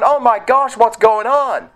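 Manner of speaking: high, loud astonished cry; amazed onlooker. Pretend. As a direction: shout it like a startled bystander. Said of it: "They're going".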